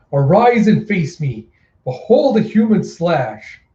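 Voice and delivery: slow, grand villain proclamation; theatrical challenge. Acted.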